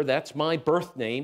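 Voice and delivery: ordinary delivery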